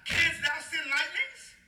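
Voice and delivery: high, slow, drawn-out scripture question; God challenging Job. Acted. Preacher reading God's question on lightnings, grandly challenging. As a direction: declaim it grandly, rising at the end. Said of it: "you got verse number thirty-five".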